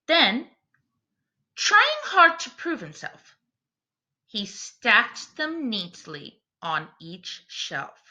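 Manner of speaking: put-on rhyming read-aloud for children; storybook reading. A narrator's reading. Teacher reading a picture book to her class, storytelling voice. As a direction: read the verse to the class with gentle rhythm.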